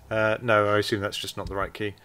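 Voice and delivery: usual speaking voice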